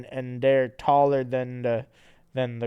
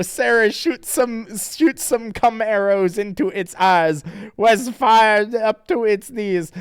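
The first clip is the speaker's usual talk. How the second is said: high, loud hammed-up heroics turning despairing; high cartoon voices; 8 semitones higher, 9 dB louder than usual. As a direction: overact brave then heartbroken. Characters voiced in comic reading.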